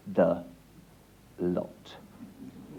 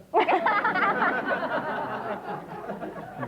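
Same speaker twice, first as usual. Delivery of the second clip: laughing at own joke; 12 semitones higher than usual. Real, not acted.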